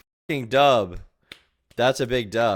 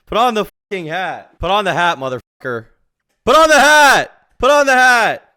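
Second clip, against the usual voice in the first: building shout; 10 semitones higher, 11 dB louder than usual. Real, not acted. Yelling at streamer on screen.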